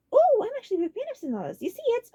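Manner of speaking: delighted to explain; excited fan; genuinely lit up. A narrator's reading. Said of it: "Ludwig's face lit up".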